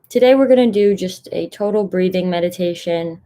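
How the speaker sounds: normal talking voice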